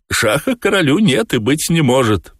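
high-pitched insistent cat; talking cat. A narrator's reading. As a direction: deny it in a high voice. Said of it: "ответил кот". (высоким голосом настаивающего кота)

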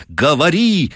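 high, insistent order. A narrator's reading. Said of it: "повторила жена ещё решительнее".

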